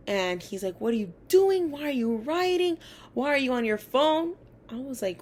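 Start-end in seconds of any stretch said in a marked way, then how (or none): 0.8-4.3 high, loud accusing yell; retold furious teacher shout. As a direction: bark the questions at the student like an angry teacher. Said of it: "he just screams my name".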